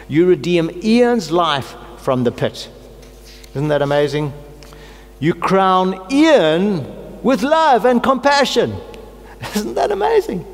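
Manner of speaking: a pastor praying the psalm in rising joy; joyful rapture. Real, not acted. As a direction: lift it up like praise breaking out. Ecstatic prayer, personalized psalm.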